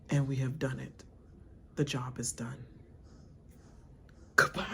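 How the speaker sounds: soft, whispered, dead-serious Danielle; imitating Danielle. Danielle handing over the letter, whispered and grave. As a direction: whisper it cold and final. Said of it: "She was like".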